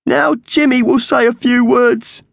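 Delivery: loud, very high squawky eulogy; grieving magpie character. Acted. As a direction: squawk a tearful farewell at high pitch. Voices Slasher the magpie.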